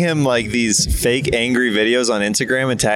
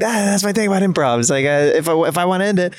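higher-pitched exasperated comic rant; Todd Glass; 7 semitones higher, faster than usual. Retold or imitated. Imitating a comedian explaining his thing about improv, higher voice. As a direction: raise the pitch and rattle off a dismissive rant. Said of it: "very Todd Glass approach".

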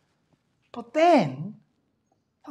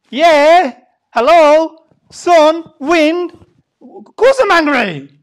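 loud, higher defiant shout; angry retort; 6 semitones higher, 15 dB louder, much slower than usual. Acted. Jonah shouts back at God that of course he's angry.

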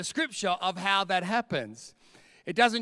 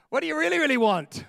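high, quick playful question; higher, laughing retake; 7 semitones higher, 6 dB louder, faster than usual. Shown as a demonstration. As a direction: toss it up with a laugh.

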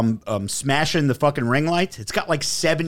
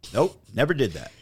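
ordinary talk

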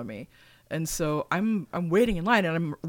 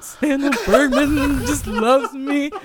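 slow, higher, louder laughing delight; giddy laughing; 9 semitones higher, 7 dB louder, slower than usual. Retold or imitated. Fan gloating about the actress's affection. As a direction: savour it with a laugh. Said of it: "I was just like".

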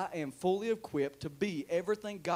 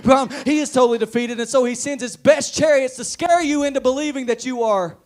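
loud, driving pulpit delivery; forceful revival preacher; full-force preaching; 6 semitones higher, 16 dB louder than usual. Real, not acted. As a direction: preach it hard at the congregation.

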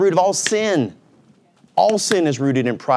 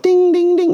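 high, louder sing-song ding ding; dashboard chime; 5 dB louder than usual. Retold or imitated. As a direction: ring it out like a warning light. Mimicking a dashboard warning chime.

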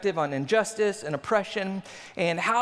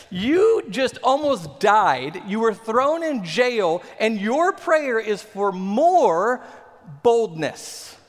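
slow, louder, disbelieving recap; mock incredulous; 5 dB louder, much slower than usual. Preacher mocks disbelief at the apostles' prayer, slow and louder. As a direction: spell it out slowly, as if it can't be true.

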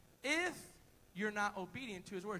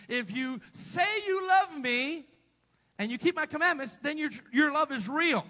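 loud preaching; 8 dB louder than usual. Real, not acted. Preacher booming the scripture to the congregation. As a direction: proclaim it to the church.

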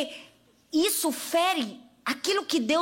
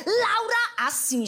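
ordinary talk

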